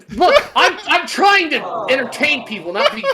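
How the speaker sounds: loud laughing protest; laughing shout. Real, not acted. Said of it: "laughing protest".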